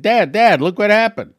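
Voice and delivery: high, loud rush of excitement; breathless excited call to dad; excited news. Retold or imitated. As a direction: burst in shouting for dad. Running to tell dad the news.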